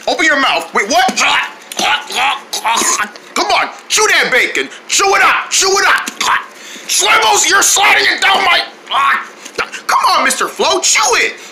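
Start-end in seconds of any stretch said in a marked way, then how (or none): 3.3-11.4 pushy shouting. Acted. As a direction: yell at him to chew. Forcing food, yelling chew.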